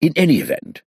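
put-on high, flustered old gentleman; fussy old man. A narrator's reading. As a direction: babble excuses like a nervous aristocrat.

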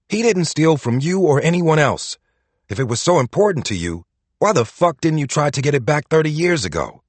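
loud furious yell. Acted. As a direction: yell it, out of control. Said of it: "he was suddenly yelling".

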